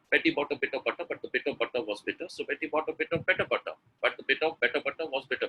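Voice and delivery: brisk, running the words together. Shown as a demonstration. Teacher raising the speed for learners, brisk and even. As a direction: pick up speed through the tongue twister. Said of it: "read it really faster than that".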